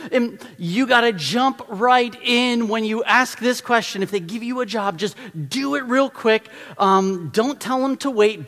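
high, loud, urgent sermon; energetic preaching. Acted. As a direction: drive the point home with energy. Preaching at full force.

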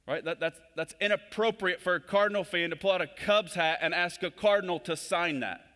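loud, high, driving sermon voice; preaching at full force. Acted. Preacher urging the church to live their identity, full-force preaching. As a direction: pound the point home to the congregation.

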